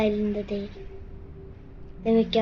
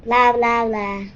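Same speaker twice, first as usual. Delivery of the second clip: mocking bla bla; 7 dB louder, slower than usual. Mocking chatter.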